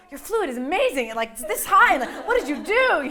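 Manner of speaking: high, loud, gushing amazement; amazed praise. Retold or imitated. Nurse reporting the scan result to the patient, gushing loudly. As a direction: gush like a stunned nurse at the scan.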